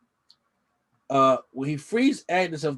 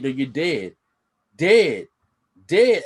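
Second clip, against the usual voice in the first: creepy repeating; 5 dB louder, much slower than usual. Retold or imitated. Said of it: "it's actually kind of creepy".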